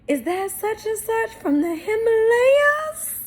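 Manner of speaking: high, strained put-on voice with a cry; Martin impression. Doing Martin's goofy voice for her viewers. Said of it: "he was like".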